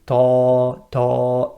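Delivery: ta with a full upward sound; heavy wrong ta. Shown as a demonstration. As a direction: incorrect heavy ta. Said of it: "that's the sound going upwards".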